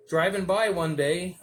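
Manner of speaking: usual speaking voice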